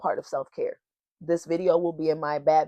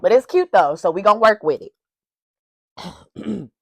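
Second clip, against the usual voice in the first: laughing; 4 semitones higher, 8 dB louder than usual. Real, not acted. Laughing off the tongue ring.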